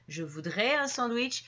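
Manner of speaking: gentle polite request. Shown as a demonstration. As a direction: ask nicely, softening the request. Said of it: "on tombe dans la demande plus polie".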